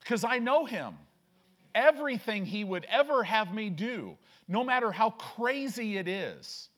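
emphatic, loud declaration of conviction; impassioned preaching. Real, not acted.

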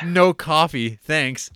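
loud, high hammy delivery; hammed-up movie line. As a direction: ham up the cheesy punchline. Quoting the movie's punchline.

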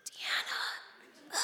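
unvoiced hushed whisper. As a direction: breathe the name in a hush. Friends calling her name.

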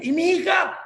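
high, loud panicked question; alarmed shout. Acted. Man asking Angel about his daughter, alarmed shout. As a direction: cry out, pitch way up.

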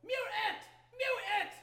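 high, repeated robotic alarm; alarmed computer voice. Acted. Game master voicing the computer sounding the alarm, high alarm. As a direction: blare it like a panicking machine. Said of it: "The computer on the monitor is like".

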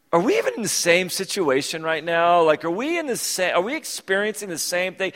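mock disbelief, loud at the start; incredulous. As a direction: scoff at your friend's cheeriness. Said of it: "I was in the car going".

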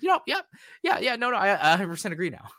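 high, fast eager yeps; emphatic mock agreement. Viewer conceding the anime is bad, high fast yeps. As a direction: agree way too hard. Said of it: "And I was like".

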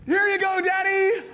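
put-on high, loud burst of kid excitement; imitating a thrilled young son; excited little kid. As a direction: shout it like a boy presenting a surprise. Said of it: "and then he goes".

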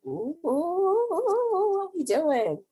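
high, loud, long-drawn oohs; gleeful anticipation. Retold or imitated. Podcaster reacting to who comes to the party, gleeful. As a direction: whoop it up at brewing drama.

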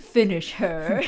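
slow, drawn-out announcer call, laughing through it; mock arcade announcer voice with a laugh; Mortal Kombat announcer. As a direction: intone it like a fighting-game announcer ordering the finishing move. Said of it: "like in Mortal Kombat, where they're like".

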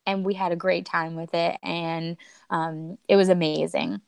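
usual speaking voice

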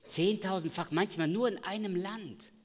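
"Zehntausendfach" called out high and loud. Shown as a demonstration. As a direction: hit "Zehntausendfach" full voice. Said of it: "repeated loud".

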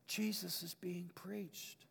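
slow, reverent whisper; hushed whisper. Acted. Preacher reading the climax of Paul's verse, whispered. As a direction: whisper it slowly, as the heart of the matter.

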